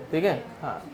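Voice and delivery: ordinary talk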